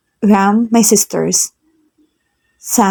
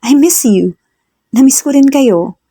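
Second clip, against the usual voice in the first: happy reunion; 6 semitones higher, 3 dB louder than usual. Acted. Reunion of cousins.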